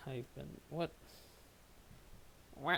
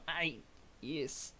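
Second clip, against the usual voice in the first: ordinary delivery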